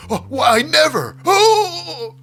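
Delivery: very high, loud hammed-up indignation; mock scandalised shock. Maxine completely stunned by the insult, mock scandalised squeal. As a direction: squeal it like an affronted lady, overdone.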